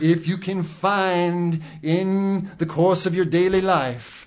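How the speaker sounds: high preacher voice; preaching. Acted. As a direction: preach it to your flock. Mock preacher voice.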